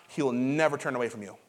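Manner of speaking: ordinary talk, nothing marked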